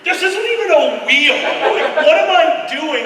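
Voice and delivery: high, loud baffled stoner rant; giggly bewildered stoner; stoned pilot voice. Retold or imitated. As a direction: play the pilot lost at the controls. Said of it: "goes over and like".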